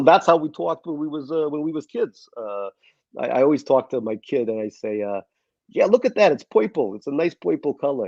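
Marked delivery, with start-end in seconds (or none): 0.0-2.3 put-on higher-pitched Queens kid accent; thick old-neighborhood Queens accent. Shown as a demonstration. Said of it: "I can do a Queen's accent". 5.7-8.1 playful Queens accent demo. Shown as a demonstration. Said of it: "I can do a Queen's accent".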